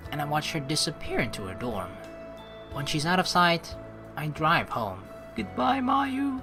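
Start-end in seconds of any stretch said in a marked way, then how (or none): none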